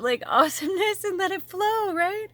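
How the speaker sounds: squealing high with a laugh; breathless giddy rush. Real, not acted. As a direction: gush about the flow, way up high. Gushing about flowing energy.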